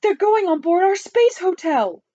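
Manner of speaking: high-pitched alarmed shout. A narrator's reading. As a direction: cry it out high. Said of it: "shouted the president".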